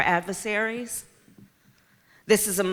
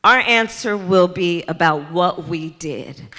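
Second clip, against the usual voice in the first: rising rally cry; 8 semitones higher, 13 dB louder than usual. Acted. Speaker rousing the Charleston crowd, building to a shout. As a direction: build from firm to full shouted rally cry, higher and louder.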